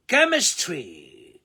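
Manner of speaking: Chemistry stretched very slow, high; slow drill word. Shown as a demonstration. Teacher drills chemistry slowly for learners. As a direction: draw out every syllable of chemistry.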